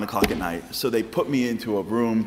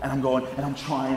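ordinary talk, nothing marked

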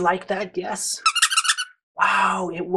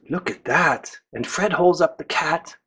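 ordinary delivery

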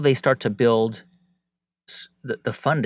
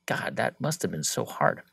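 soft, lower, sympathetic sigh; empathetic; 6 semitones lower, 6 dB quieter than usual. Pretend. Listener offering empathy to someone telling a hard story, soft. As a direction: say it quietly, feeling for them. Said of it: "I'm really like".

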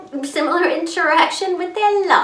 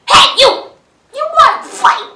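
much higher, loud squeaky challenge; grouchy challenge; 11 semitones higher, 9 dB louder than usual. Acted. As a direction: pick a fight in a tiny high voice. Ladybug challenges stag beetle.

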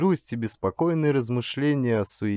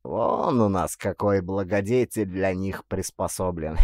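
smug; 3 semitones lower than usual. A narrator's reading. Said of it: "самодовольно ответил писарь".

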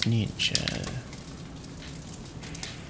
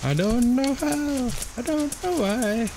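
high whiny voice; 13 semitones higher than usual. Acted. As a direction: whimper like a lost little creature. Confused whining at game.

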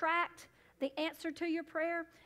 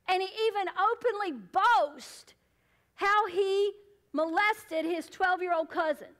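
raised, emphatic pulpit denunciation; forceful preaching; 4 semitones higher, 6 dB louder than usual. Real, not acted. Preacher denouncing a spokesman to congregation, forceful preaching. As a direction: hammer out the charges with heavy punched words.

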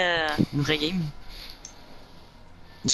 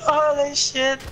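ordinary delivery

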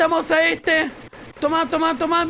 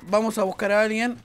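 normal talking voice

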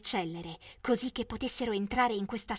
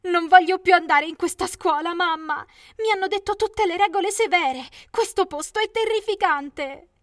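high, loud frightened protest; a daughter telling her mother the school scares her; upset refusal; 7 semitones higher, 7 dB louder than usual. Acted. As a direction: push back hard, high and loud.

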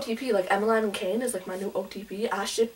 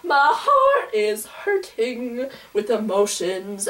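slow, drawn-out lament; slow melodramatic lament; mock heartache; much slower than usual. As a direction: moan it slowly. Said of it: "I was like".

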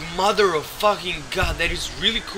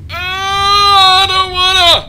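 high, loud hammed-up wail; mock crying whine; 14 dB louder, slower than usual. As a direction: whimper like a sulking child, slowly. Whining at the game.

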